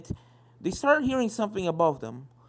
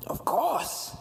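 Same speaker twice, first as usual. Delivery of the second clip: ghostly whisper; 9 semitones higher than usual. Acted. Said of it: "the portrait of a gray stallion said".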